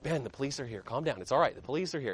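low, hushed reassurance; calming down. Retold or imitated. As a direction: talk an angry friend down, softly. Calming his furious friend down.

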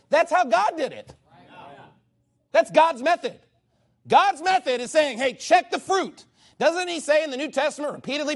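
rising preacher's fervour; forceful preaching. Acted. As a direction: drive it home with conviction.

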